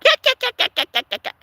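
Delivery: high, mocking yak yak; mock yakking. Fed up with coworkers chatting, mocking yak yak. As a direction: sneer out the chatter.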